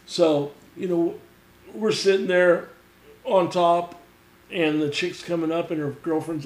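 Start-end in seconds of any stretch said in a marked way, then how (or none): none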